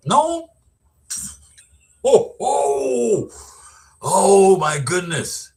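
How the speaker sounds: high, loud cry of disbelief; shocked exclamation. Real, not acted. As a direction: gasp it out, truly stunned, dragging out the last words. Shocked by a cold witness.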